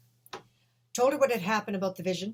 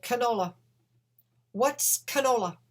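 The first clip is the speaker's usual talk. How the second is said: higher and louder, slowed, strange-sounding voice; vision voice; 6 semitones higher, 7 dB louder than usual. Retold or imitated. The vision names canola.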